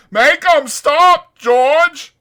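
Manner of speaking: slow, high, loud bleating plea; Lenny crying out to George; terrified cry. Acted. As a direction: bleat with terror. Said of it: "He cried".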